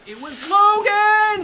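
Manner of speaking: high, loud, slow twist; mock dramatic reveal. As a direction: announce the villain's entrance.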